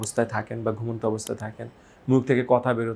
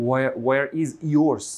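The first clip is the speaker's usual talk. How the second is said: slow wrong version; much slower than usual. Shown as a demonstration. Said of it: "নেটিভরা এটা বলবে না যে".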